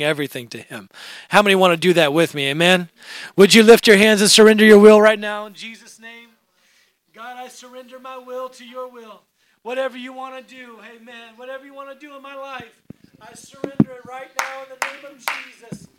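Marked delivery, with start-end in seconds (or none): 3.4-6.3 loud, fervent call to prayer; preacher urging the whole congregation; altar call. Real, not acted. Calls congregation to pray. 7.1-15.9 much softer, slightly raised praying voice; distant, earnest prayer of surrender; fervent prayer. Real, not acted. Prays, voice dropped far back.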